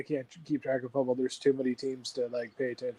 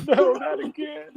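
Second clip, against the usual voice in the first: high, slow laughing wail; wailing through a laugh; mock crying laugh; 11 semitones higher, 4 dB louder, slower than usual. Fan crying at a commercial, mock wail through laughter. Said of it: "He's like".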